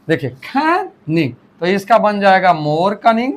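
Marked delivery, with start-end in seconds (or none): 0.3-1.3 canning said slowly as can, short gap, ning; split into syllables. Shown as a demonstration. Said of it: "इसमें दो syllable हैं". (canning को तोड़कर धीरे बोलें: कैन... निंग)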